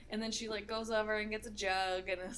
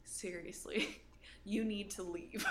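softer, slow and calm with mom; 2 semitones lower, 6 dB quieter, slower than usual. Retold or imitated. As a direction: ease her out the door gently. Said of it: "I just had the most calm tone with her".

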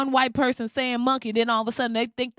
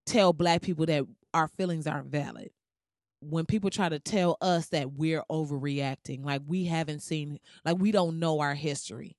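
lower, softer, weary seriousness; subdued, aggravated; 5 semitones lower, 5 dB quieter than usual. Real, not acted. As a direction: bring it down and speak soberly. Calms down, speaks gravely of hurt.

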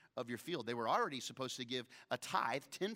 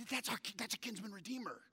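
fast excited line; 7 semitones higher, 3 dB quieter, faster than usual. Retold or imitated. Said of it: "She said".

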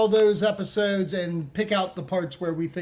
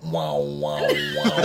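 very low, droning comic voice; deep droning wows, badly imitating a quote; mock didgeridoo drone. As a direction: sound like a didgeridoo. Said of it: "This was the quote".

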